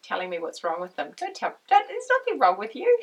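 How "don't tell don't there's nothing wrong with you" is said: high, loud mock protest; loud, high-pitched imitation of protesting; protesting reassurance. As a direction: insist emphatically, pitched well up. Acted protest to a self-critical person.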